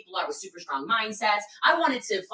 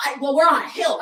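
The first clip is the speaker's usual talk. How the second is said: louder, higher mock protest; blaming complainer; 5 semitones higher, 9 dB louder than usual. Walker blaming the hill, mock protesting. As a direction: imitate a loud, whiny excuse. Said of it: "this person starts blaming".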